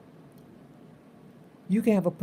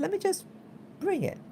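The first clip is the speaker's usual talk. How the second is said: chirpy self-quote; 13 semitones higher, 3 dB quieter, faster than usual. Retold or imitated. Retells deciding to take the body cam.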